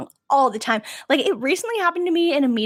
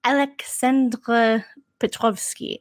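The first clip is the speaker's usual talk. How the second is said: Russian pronunciation; much slower than usual. Retold or imitated. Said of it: "he's like".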